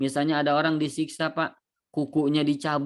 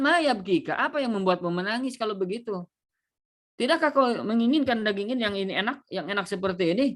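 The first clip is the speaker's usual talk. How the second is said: higher, insistent questioning; questioning onlookers; 5 semitones higher than usual. Acted. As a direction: press him on why he cries. Onlookers ask again. (suara orang yang mendesak bertanya, lebih tinggi)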